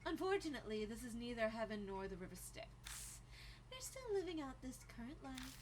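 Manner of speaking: lilting, quiet delivery of wry news; Rosé, wry. Acted. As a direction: play the companion lightly and softly. Plays Rosé in game dialogue.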